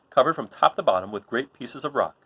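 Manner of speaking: plain reading voice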